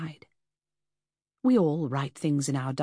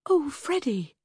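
dismayed; 10 semitones higher, slower than usual. A narrator's reading. Shocked sympathy outburst.

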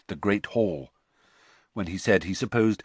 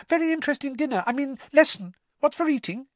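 unnaturally high voice; faster than usual. Acted. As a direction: voice jumps way up, strained and false. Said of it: "in the same unnaturally high voice".

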